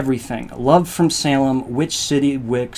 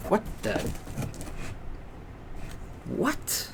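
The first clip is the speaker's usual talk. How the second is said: a breathless what jumping much higher; startled what; 9 semitones higher, 10 dB quieter than usual. Real, not acted. Opening the box live and finding a surprise, stunned disbelief. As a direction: gasp it out in disbelief.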